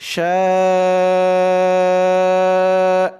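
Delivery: شاء with the long aa held for four counts, loud and steady; four-alif stretch. Shown as a demonstration. Teacher demonstrating the four-count madd on شاء, long held vowel. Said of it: "এখানে চার আলিফ টান হবে মদ্দে মুত্তাসিল".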